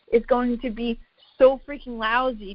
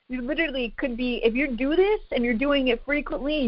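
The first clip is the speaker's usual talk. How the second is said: ordinary delivery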